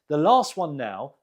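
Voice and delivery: normal talking voice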